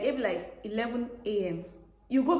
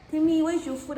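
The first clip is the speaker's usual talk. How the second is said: higher, louder mock questioning; imitated schoolmates; 7 semitones higher, 5 dB louder than usual. Schoolmates questioning her lunch, imitated in a higher voice. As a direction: imitate a puzzled schoolmate, pitched up. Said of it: "They'll be like".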